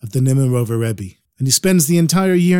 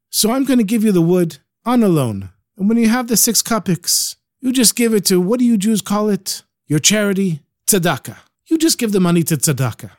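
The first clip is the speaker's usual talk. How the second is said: put-on Ukrainian accent; 7 semitones higher than usual. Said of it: "He speaks with a real Ukrainian accent".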